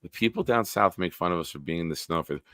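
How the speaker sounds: usual speaking voice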